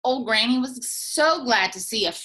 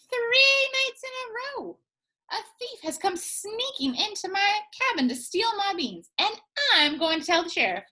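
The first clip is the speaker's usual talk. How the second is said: a higher fussy granny telling her woes; Granny's voice; 10 semitones higher than usual. Acted. Granny telling the cactus about the bean thief, high fussy voice.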